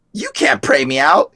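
high-pitched demon taunt. Retold or imitated. Demon speaking through a man to him, high mocking taunt. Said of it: "the demon spoke through the man".

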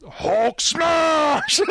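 high, hammed-up Hulk smash cry; mock Hulk shout. Friend imitates kid yelling at the movie, hammed-up shout. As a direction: yell it like the kid playing Hulk. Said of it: "I was like".

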